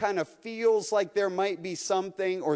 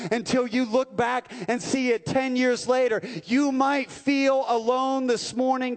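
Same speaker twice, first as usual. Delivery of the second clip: preaching; 2 semitones higher, 3 dB louder than usual. Real, not acted. The pastor urges the lonely listener they are not alone, building preaching shout. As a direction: preach it until the last words ring out.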